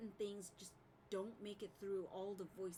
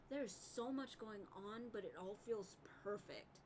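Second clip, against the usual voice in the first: usual speaking voice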